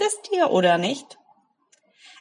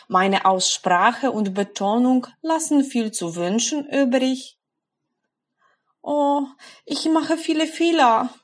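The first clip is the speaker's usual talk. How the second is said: worried learner; 4 semitones higher than usual. Acted. Voices a worried learner's doubts.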